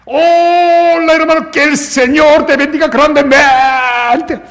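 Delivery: high, loud, very slow and gushing Spanish greeting; overjoyed pastor hailing his flock; exuberant greeting. Acted. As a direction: throw your arms open and gush the welcome. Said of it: "호들갑을 떨기 시작했습니다".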